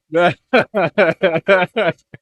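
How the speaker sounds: big burst of laughter; loud laugh. Real, not acted. Laughing at memory.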